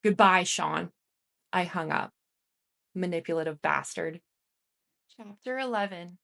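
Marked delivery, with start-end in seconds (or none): 0.0-0.9 firm, louder final goodbye; resolute, no-going-back farewell; with much more conviction. A narrator's reading. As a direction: close the door on him for good. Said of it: "with much more conviction".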